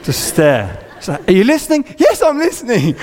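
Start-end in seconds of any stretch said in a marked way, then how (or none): 1.3-1.9 high-pitched mock nagging question; imitated wife. Wife challenges him, retold. 2.0-3.0 high-pitched defensive reply; mock exasperation.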